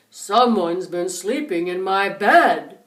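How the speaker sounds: deep, slow, loud bear voice; big low papa bear boom. A narrator's reading. Papa bear finds his bed slept in, deep and slow. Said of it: "and he said".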